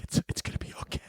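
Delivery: hushed, unsteady mock reassurance; whispered self-soothing. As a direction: comfort the mop under your breath. Comforting the mop in the closet.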